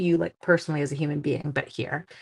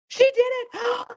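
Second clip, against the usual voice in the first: very high, excited squeal; triumphant cheer; 3 dB louder, much slower than usual. Real, not acted. Cheering herself for lining up the stamp, triumphant squeal. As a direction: celebrate in a high-pitched sing-out.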